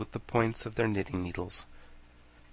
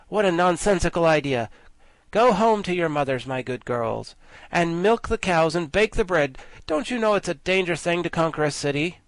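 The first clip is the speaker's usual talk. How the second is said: condescending guardian; 6 semitones higher, 5 dB louder than usual. A narrator's reading. Guardian scolds the girls.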